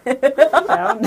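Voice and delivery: laughing. Real, not acted. Laughing at own joke.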